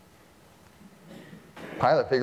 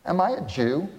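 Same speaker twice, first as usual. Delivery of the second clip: a lightly voiced sneer, pitched up; snarky Pilate; 7 semitones higher than usual. Preacher voicing Pilate's sneer at Jesus, mock snarky. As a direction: toss the question off with scorn. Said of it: "And Pilate gets snarky".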